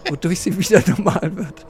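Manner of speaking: giggling through the words; trying not to laugh; stifled giggles. Real, not acted. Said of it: "ich kann nicht aufhören zu lachen".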